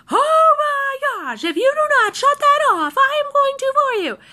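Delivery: very high, wailing mock rage; imitating angry brother. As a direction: screech a threat like a brother at breaking point. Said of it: "He's getting really, really angry".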